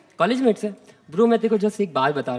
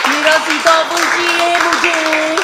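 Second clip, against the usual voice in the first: put-on high, slow whining girl voice; whiny third roommate; 7 semitones higher, slower than usual. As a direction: drag it out like a sulky roommate who lost her fruit. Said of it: "उनकी तीसरी roommate आ गई". (ऊँची, धीमी, रोनी सी roommate की नकल)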